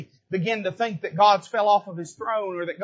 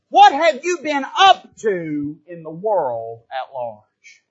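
slow, lower, measured voice of God; God questioning Satan; 5 semitones lower, 3 dB louder, slower than usual. Retold or imitated. Preacher voicing God questioning Satan, slow and solemn. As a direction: draw it out like a solemn judge. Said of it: "he's looking at him and saying".

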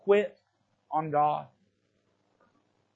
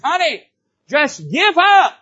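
higher, louder pleading wife; voicing the wife; 9 semitones higher, 10 dB louder, slower than usual. Preacher imitating Job's wife urging him to quit.

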